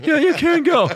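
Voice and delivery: put-on high, loud squeaky kid voice; mock a timid kid straining for authority; teenage bouncers. Teen bouncers ejecting a drunk, high mocking kid voice. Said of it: "like, sixteen-year-old kids".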